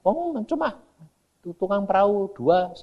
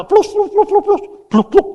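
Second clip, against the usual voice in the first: imitated gunfire; 13 semitones higher, 5 dB louder than usual.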